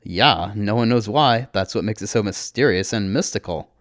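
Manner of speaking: ordinary delivery, nothing marked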